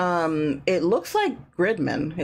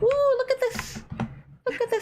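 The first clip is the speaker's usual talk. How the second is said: very high curious cat voice; squeaky voice for the cats. Retold or imitated. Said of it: "they're still like".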